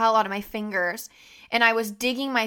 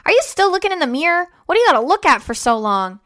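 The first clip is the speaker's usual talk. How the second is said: higher, louder, a bit nagging dad; dad's voice; 6 semitones higher, 8 dB louder, faster than usual. Retold or imitated. Dad teasing daughter at the mirror, higher, louder. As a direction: mimic dad teasing from the couch. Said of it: "and then he goes".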